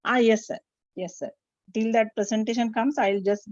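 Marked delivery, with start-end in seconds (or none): none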